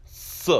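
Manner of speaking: "S" as a hissed "suh"; Sadi sound. Shown as a demonstration. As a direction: sound out "S", not the letter name. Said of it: "The Sadi is pronounced as".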